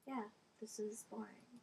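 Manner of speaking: whispered aside, slow; softly whispered remark. Real, not acted. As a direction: hush it so no one hears. Whispering during recording.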